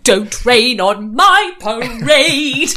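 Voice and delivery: loud, slow, badly faked British accent; failed British accent. Shown as a demonstration. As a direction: belt it out in hammy British. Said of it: "I sound like Kyle doing an impression".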